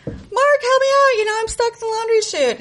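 put-on little girl begging Mark to pull her out; pleading kid. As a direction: stretch out the plea to the big cousin. Begging the oldest cousin.

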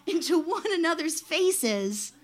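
usual speaking voice

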